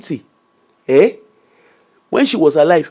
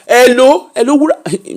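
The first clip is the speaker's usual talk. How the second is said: higher, louder call mimicking mom; mom's raised hello on the phone; imitating mother calling; 7 semitones higher, 3 dB louder than usual. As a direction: call out for your daughter like an insistent mother. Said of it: "She said".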